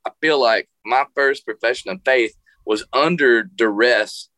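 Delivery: ordinary talk, nothing marked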